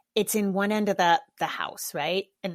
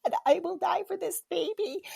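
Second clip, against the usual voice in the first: gushing; 9 semitones higher than usual. Retold or imitated. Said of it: "And I was like".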